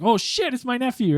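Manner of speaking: high, startled recognition; a touch of shocked uncle; surprised uncle. Retold or imitated. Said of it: "He's like".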